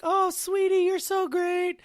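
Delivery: sky-high, gushing sweet judge voice. Retold or imitated. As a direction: coo the praise like the kind TV judge. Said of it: "her job was to be like".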